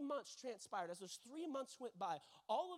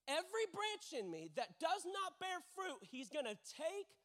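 measured, weighty verse, repeated higher; dramatic scripture reading; 3 semitones higher, slower than usual. Real, not acted. Preacher reading the problem verse to the congregation, dramatic scripture reading. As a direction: read it out slowly with heavy pauses.

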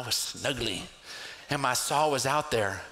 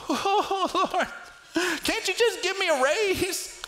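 much higher, nasal-free whining plea; mock whine. Believer begging God for a raise, high comic whine. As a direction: whine like a spoiled child.

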